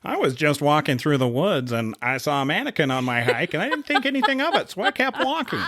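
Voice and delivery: hammed-up true-crime narrator, giggling; true crime podcast voice. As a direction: play the stunned podcast witness. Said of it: "What is this voice you're doing?".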